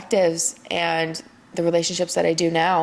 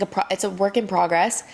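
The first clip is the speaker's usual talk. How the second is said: ordinary talk, nothing marked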